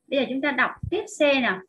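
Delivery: usual speaking voice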